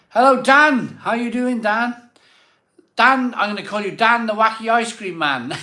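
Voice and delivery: high, loud, gleeful hello; big hammy shout-out to a fan; excited loud greeting. Real, not acted.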